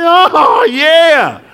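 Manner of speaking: a sudden high exultant "Oh, yeah"; triumphant shout. Retold or imitated. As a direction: crow with delight at the good news. Triumphant retold reply.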